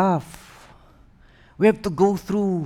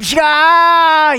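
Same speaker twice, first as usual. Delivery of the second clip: whoosh sound; 12 semitones higher, 11 dB louder than usual. Retold or imitated. Sudden fall off cliff.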